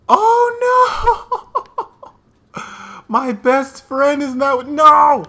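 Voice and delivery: high, loud laughing wail of despair. Real, not acted. Gamer reacting to losing his best friend, laughing wail. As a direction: cry out in laughing disbelief.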